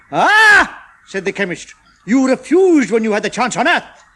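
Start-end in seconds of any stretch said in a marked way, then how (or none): 0.1-0.8 high, loud gloating "Ah"; chemist exclaiming. A narrator's reading. Chemist catching out Uncle Roger, loud and high. 2.1-4.0 a fussy alien chemist calling Uncle Roger back. A narrator's reading. Chemist calling the customer back, high and loud.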